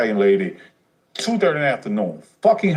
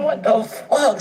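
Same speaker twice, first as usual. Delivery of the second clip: shocked; 12 semitones higher, slower than usual. Retold or imitated. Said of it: "So I'm like".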